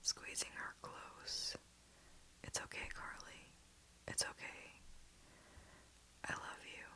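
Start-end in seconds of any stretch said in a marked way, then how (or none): 2.4-6.9 soft, quiet, loving whisper; holding her before the crash, tender; tender farewell. Acted. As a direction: say goodbye gently. Last words before crash.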